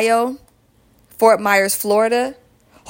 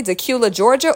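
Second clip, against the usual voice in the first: usual speaking voice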